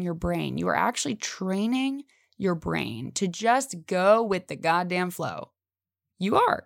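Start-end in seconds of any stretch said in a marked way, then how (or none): 6.2-6.6 emphatic insistence. Real, not acted.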